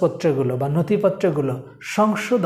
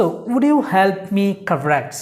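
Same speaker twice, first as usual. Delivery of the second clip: slow dictation; much slower than usual. Shown as a demonstration. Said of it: "next word আমরা শিখবো".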